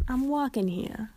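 hammed-up New York accent. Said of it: "I can't do a New York accent".

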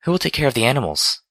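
high, hurried worried question; fretting. A narrator's reading. Fluttershy thinking of her animals, fretting. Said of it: "she fretted".

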